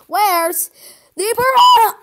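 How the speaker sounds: loud, high repeated question; insistent demand. Acted. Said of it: "I said".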